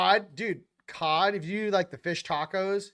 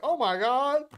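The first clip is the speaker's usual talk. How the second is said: higher and louder, faux gasp; mock shock; 8 semitones higher, 4 dB louder than usual. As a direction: act startled in a playful way. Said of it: "You're like".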